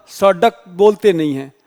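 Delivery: usual speaking voice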